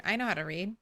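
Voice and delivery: ordinary talk, nothing marked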